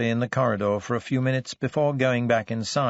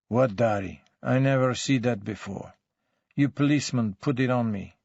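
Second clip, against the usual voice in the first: put-on sullen denial in accented, broken English; foreign-accented suspect, grudging and defensive; surly foreign suspect. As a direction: deny it all like a surly immigrant suspect. Surly suspect denies everything.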